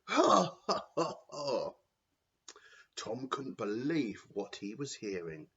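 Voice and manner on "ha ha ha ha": loud, high villain's cackle; evil cackle. Acted. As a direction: cackle with glee at the cruelty. Villain laughing at cruelty.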